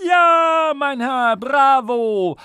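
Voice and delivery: very high, loud ecstatic cheer; radio host shouting. Acted. Radio host screaming bravo to winner, very high ecstatic. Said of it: "hat der Herr im Radio geschrien".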